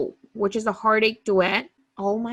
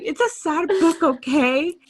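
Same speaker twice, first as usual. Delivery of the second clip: defensive; 8 semitones higher than usual. Retold or imitated. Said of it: "I was like".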